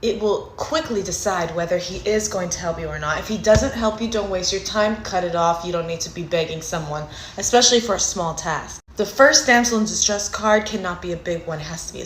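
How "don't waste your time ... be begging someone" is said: ordinary talk, nothing marked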